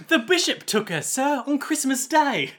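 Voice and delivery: high, loud, hammed-up Cockney urchin; slow, high-pitched Cockney orphan. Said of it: "more of a Cockney orphan".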